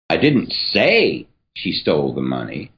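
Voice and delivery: emphasis on "say"; stress on say. Shown as a demonstration. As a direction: say lifted and stressed. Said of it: "emphasis here is going to change every single time".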